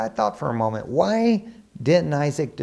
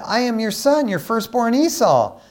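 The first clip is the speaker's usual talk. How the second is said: lightly acted son, pitched high; earnest son's reply; 9 semitones higher, 4 dB louder than usual. Esau identifies himself to his father, lightly acted insistence. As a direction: answer father in a raised, insistent voice.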